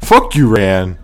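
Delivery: put-on loud jokey Jersey accent. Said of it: "Why was that also in a Jersey accent?".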